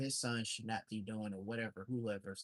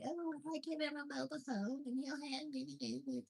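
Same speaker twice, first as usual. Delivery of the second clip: mocking squeaky high impression of the woman; mocking her voice; 5 dB quieter than usual. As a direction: mimic her in a silly high-pitched voice. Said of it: "you turn around and you say".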